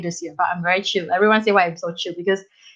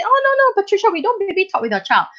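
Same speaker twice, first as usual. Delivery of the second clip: protesting parent; 10 semitones higher, faster than usual. Retold or imitated. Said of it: "they say".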